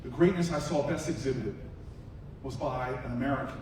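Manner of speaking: hushed solemn speech. Acted. Senator honoring the old man with the light to the crowd, hushed and solemn. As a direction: bring the room in close and hush it.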